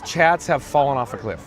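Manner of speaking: ordinary talk, nothing marked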